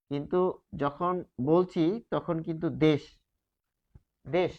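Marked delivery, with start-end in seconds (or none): 4.4-4.5 দেশ stopped dead on শ; clipped ending. Shown as a demonstration. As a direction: cut দেশ off after the শ. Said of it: "থেমে যাচ্ছি". (দেশ হসন্তের মতো থেমে)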